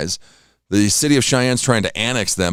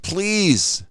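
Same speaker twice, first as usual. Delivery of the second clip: much higher, whining please to be left asleep; whiny plea; 8 semitones higher, much slower than usual. Retold or imitated. Pleading with the cat for sleep, higher and whiny. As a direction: beg to sleep like a worn-out kid. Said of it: "I'm like".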